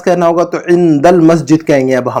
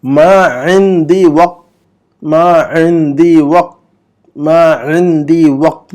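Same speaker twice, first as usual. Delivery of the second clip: मा इंदी वक्त drawn out slowly, a little louder; slow model reading; 4 dB louder, much slower than usual. Shown as a demonstration. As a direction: say it slowly for students to repeat. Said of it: "मैं pause देकर". (धीमा, साफ़ उच्चारण)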